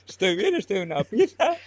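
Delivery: high, loud laughing through the words; giggling, shaken relief after the gorilla. Retold or imitated. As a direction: laugh off the fright. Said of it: "Y yo diciendo".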